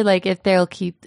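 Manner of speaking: usual speaking voice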